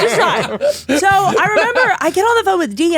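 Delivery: high giggly telling; laughing. Real, not acted. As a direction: laugh while retelling. Laughing at the story.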